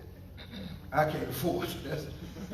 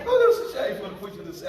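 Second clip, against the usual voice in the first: quick playful retort; 2 semitones higher, 4 dB louder, much faster than usual. Retold or imitated.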